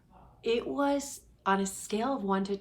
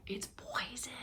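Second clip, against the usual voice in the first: whisper; 4 semitones higher, 10 dB quieter than usual. Real, not acted. Whispering a secret aside.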